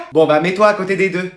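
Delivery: normal talking voice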